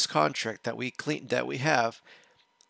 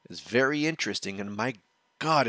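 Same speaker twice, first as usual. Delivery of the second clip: usual speaking voice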